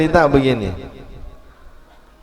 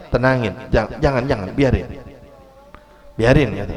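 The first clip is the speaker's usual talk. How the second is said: soft and lower, hushing them; calming; 4 semitones lower, 5 dB quieter than usual. Acted. The Prophet telling his angry companions to let it be. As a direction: settle them down gently.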